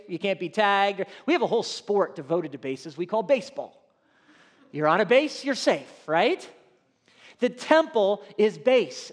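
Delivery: ordinary delivery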